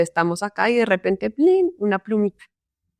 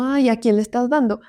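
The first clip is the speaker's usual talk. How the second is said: ordinary talk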